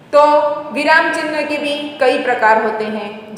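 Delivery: usual speaking voice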